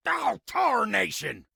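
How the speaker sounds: high, frustrated country cuss; exasperated outburst. Acted. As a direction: let the cuss burst out high. Cursing the situation.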